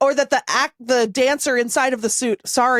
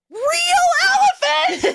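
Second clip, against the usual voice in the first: very high, drawn-out exclamation; high-pitched indignant wail with laughter in it; mock outrage; much slower than usual. Real, not acted. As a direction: cry it out slowly in comic indignation. Mock-outraged climax of a rant.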